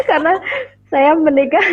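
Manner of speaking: light laugh, pitch up; chuckling self-consciously. Real, not acted. Guest laughs at herself.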